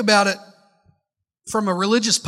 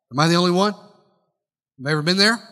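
ordinary talk

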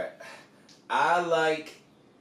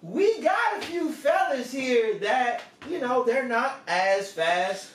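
Southern coach drawl. Pretend. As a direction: play a folksy Southern coach sizing up a recruit. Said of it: "Nick says".

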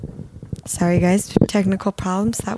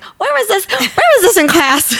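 much higher, louder mock outrage; exasperated disbelief; 13 semitones higher, 6 dB louder, slower than usual. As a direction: squeal it like you just can't believe it. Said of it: "I'm like".

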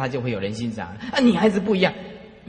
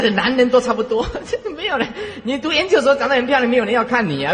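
laughing aside; 7 semitones higher, 3 dB louder than usual. Real, not acted. Laughing about men.

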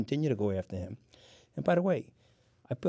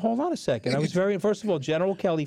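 loud protest; 5 semitones higher, 3 dB louder, faster than usual. Real, not acted. Protesting being accused of lying.

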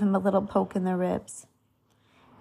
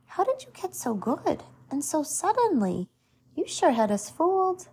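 high-pitched, mildly excited amazement; lightly voiced astonished kids; impressed boys' calls; 7 semitones higher than usual. A narrator's reading. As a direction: marvel at it in a higher voice. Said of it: "shouted the other boys".

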